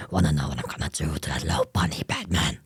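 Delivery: quick, menacing whispered growl; Batman. Acted. Streamer threatening Batman's bunny as Joker, whispered growl. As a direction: rasp it low and breathy. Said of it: "That's Batman's voice, not Joker's".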